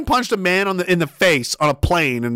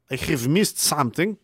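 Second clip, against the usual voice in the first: normal talking voice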